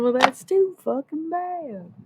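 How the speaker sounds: high, slow, sarcastic retort; mocking retort. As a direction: drag it out with sass. Retort to the ancestors.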